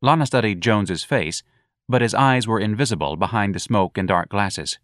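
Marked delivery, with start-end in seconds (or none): none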